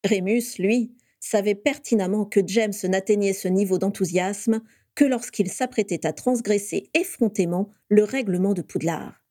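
ordinary delivery

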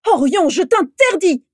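furious high-pitched shout; beside herself. A narrator's reading. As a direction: shout him down in outrage. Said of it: "Vera se leva de son siège hors d'elle". (cri furieux, hors d'elle)

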